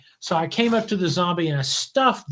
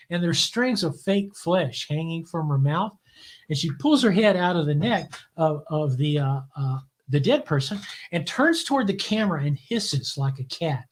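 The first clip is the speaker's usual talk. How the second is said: ordinary delivery, nothing marked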